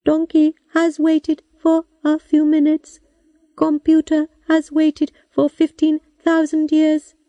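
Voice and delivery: high machine voice with a touch of sadness; sad computer voice. A narrator's reading. Robot computer speaks.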